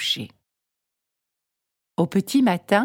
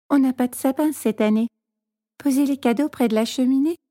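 put-on high, fast, rushed little girl; shy little girl; 5 semitones higher, faster than usual. A narrator's reading. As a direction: hurry it out, head lowered.

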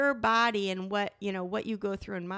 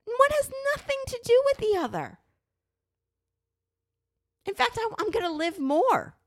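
heated insistence; 12 semitones higher than usual. Real, not acted. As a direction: push the point up high, outraged. Arguing against judgment.

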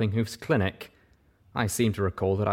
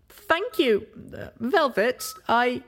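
sheepish Pip; slower than usual. Acted.

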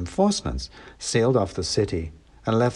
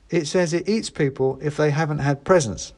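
put-on higher kid voice; know-it-all teen; boy voice; 7 semitones higher than usual. A narrator's reading. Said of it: "said the boy".

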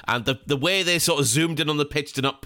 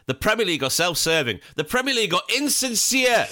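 mock banner slogans; 8 semitones higher than usual. Mock protest banner slogans chanted.

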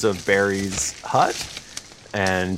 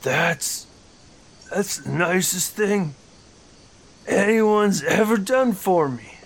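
touched Barry; 8 semitones higher than usual. Acted.

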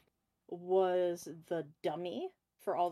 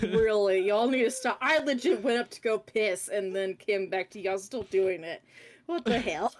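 loud exasperated scolding; louder, fed-up disbelief; 2 semitones higher, 8 dB louder than usual. Real, not acted. Exasperated at friends' ongoing bit.

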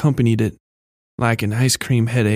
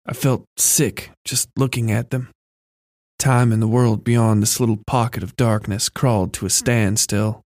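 ordinary delivery

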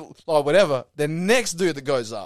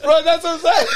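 high, loud agreement through laughter; helpless laughter breaking into the words; laughing; 12 semitones higher, 7 dB louder than usual. Real, not acted. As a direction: barely get it out for laughing. Cracking up mid-story.

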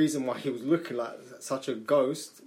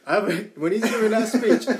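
laughing; 7 semitones higher, 8 dB louder than usual. Real, not acted. Breaks up laughing while talking.

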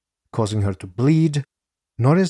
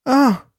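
short, high, loud "ah" cry; sharp mock scream of pain; acted scream; 6 dB louder than usual. A narrator's reading. Narrator voices victim struck again, short acted scream. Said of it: "Screams as Norris again".

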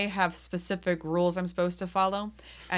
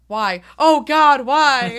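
loud, high mock despair, why oh God why; hammed-up cry of despair; mock anguished wail; 6 semitones higher, 12 dB louder, much slower than usual. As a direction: wail it like a hammed-up tragic victim. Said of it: "And he goes".

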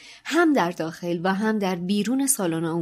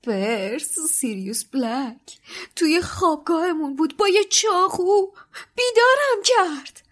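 weak voice; 9 semitones higher, 4 dB louder than usual. A narrator's reading. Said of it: "با صدای ضعیفی گفت".

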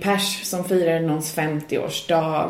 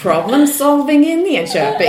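ironic punchline; 7 semitones higher, 7 dB louder than usual. Retold or imitated. Punchline about the slap.

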